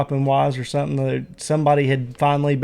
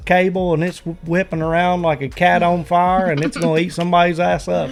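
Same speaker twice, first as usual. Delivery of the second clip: mock old Appalachian man, higher and excited; old-timer Appalachian drawl; 3 semitones higher than usual. Old Appalachian man ranting about a downed power line, mock Appalachian accent. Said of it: "they'd be like".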